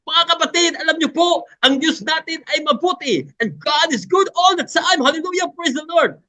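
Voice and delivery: shrill-high crusade preacher demo, racing at the close; high-pitched preaching. Shown as a demonstration. Demonstrating to preachers their always-high sermon style, very high pitched. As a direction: preach it way up high, sustained to the end. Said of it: "your style of, uh, preaching is like, uh, high pitch".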